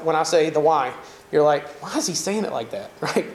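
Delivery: "the why" as "the wa", the diphthong flattened Southern style. Shown as a demonstration. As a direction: say why as a long flat wa. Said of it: "you hear it? The why?".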